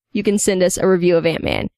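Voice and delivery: ordinary delivery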